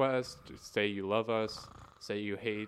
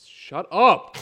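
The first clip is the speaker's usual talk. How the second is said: loud shout; 10 semitones higher, 17 dB louder than usual. Real, not acted. Annoyed at beeping cohost.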